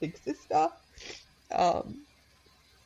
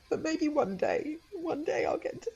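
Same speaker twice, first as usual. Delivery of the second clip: put-on hopeful child; 12 semitones higher than usual. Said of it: "she was just like".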